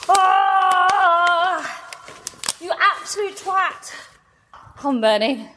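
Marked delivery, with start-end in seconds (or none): 0.1-3.9 exasperated cry. Real, not acted. Forgot car keys.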